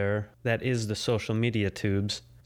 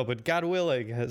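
ordinary delivery

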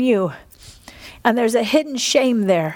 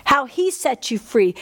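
normal talking voice

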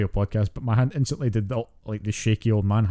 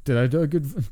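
normal talking voice

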